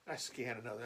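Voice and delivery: usual speaking voice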